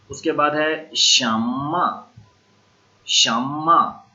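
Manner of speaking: शम्मा said slowly with a doubled m, falling tune; slow model word. Shown as a demonstration. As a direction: draw out शम्मा with a held m for the class. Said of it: "शीन मीम आइन". (शम्मा धीमे, लंबे म के साथ)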